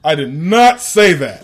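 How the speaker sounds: loud, slow protest, stress on not; loud denial. Real, not acted. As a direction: insist loudly, slowly. Denies partner's joke.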